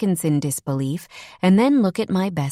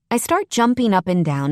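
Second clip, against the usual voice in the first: ordinary delivery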